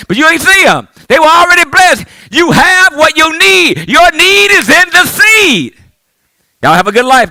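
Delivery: normal talking voice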